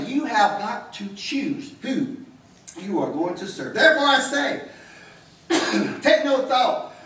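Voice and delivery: fervent sermon, pressing the congregation; preaching. Acted. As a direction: preach it out with full conviction.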